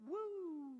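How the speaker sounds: high-pitched "woo" of fun; imitated joyride whoop; gleeful whoop. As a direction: whoop as if riding over a bump. Imitating fun over speed bumps.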